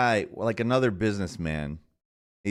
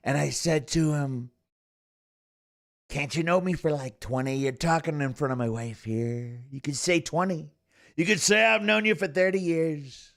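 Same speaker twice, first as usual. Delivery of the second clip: raised-pitch anecdote impression; Donald Trump; 4 semitones higher than usual. Streamer retelling Trump's wife joke, Trump impression. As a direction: parody the president complaining about a friend. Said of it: "I think he said sixty years".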